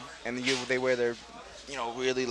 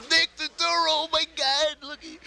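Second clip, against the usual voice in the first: much higher, louder fan squeal that fades at the end; starstruck gush; 6 dB louder than usual. Retold or imitated. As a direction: gush over the star walking in. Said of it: "I'm like".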